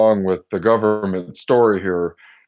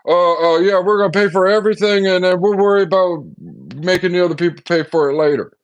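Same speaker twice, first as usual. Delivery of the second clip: high, loud breezy brush-off; lightly hammed-up politician waving it away; mock president; 9 semitones higher, 4 dB louder than usual. Mocking the president's breezy promise to pay for everything. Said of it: "And he's like".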